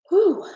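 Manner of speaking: a sharp, high, loud whew to hold back tears; emotional exhale. Real, not acted. To her viewers while welling up, a loud high emotional whew. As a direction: blow out the feeling in one loud high breath. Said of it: "I'm getting emotional".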